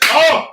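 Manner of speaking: high, loud pained oh; pained cry. Retold or imitated. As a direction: yelp from a hard hit. Reacting to being hit.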